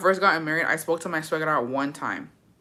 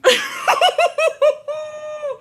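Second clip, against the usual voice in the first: loud laugh, much higher; high shrieking laugh; 5 dB louder than usual. Real, not acted. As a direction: burst into a high cackle. Laughs at her own story.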